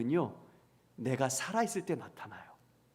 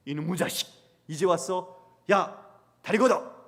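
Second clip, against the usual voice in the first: scolding father; 3 semitones higher, 7 dB louder than usual. Acted. Father to returning prodigal son, mock angry scolding. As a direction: tell off the returning child in a loud, acted outburst.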